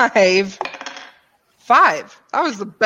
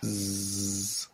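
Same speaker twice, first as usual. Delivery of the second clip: soft, low bee buzz; 12 semitones lower, 15 dB quieter than usual. Acted. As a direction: imitate bees with a quiet sustained zzz. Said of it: "start buzzing a little bit more intensely".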